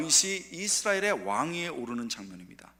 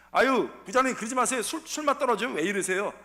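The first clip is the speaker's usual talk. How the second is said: loud, higher nagging complaint; whining coworker; 7 semitones higher, 6 dB louder than usual. Pretend. Coworker whining to the manager at dinner, loud. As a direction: whine at the boss like a tipsy colleague. Coworker mocking at a drinking party.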